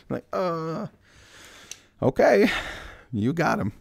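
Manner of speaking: high, sarcastic okay; okay squeaked up high in disbelief. Acted. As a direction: scoff at them with mock agreement. Sarcastic reply to the priests.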